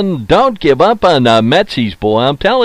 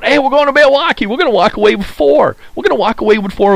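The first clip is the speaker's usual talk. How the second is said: much higher, brash mock Saint Louis fan voice; cocky Cardinals fan; 12 semitones higher, faster than usual. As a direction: ham up a boastful rival fan. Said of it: "Saint Louis is going".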